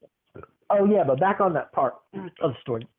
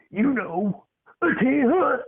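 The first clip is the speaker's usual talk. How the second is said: high drill-sergeant grandma; 10 semitones higher than usual. Retold or imitated. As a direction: sweetly demand it like a drill sergeant granny. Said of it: "you say".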